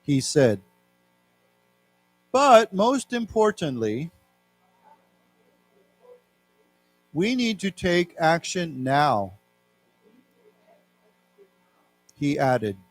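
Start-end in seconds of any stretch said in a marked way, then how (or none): 2.3-12.8 careful, slow reading of a news quote; measured classroom reading; slow read-aloud. Shown as a demonstration. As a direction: read it out slowly for learners.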